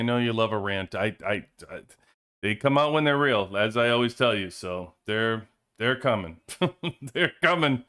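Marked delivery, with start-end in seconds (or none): none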